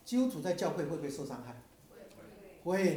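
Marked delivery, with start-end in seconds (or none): 2.6-3.0 loud congregation answer. Real, not acted. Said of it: "你看这么大声".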